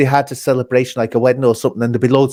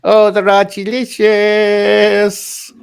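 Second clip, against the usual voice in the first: drawn-out relish; 6 semitones higher, much slower than usual. Pretend. Drawn-out echo of a catchphrase.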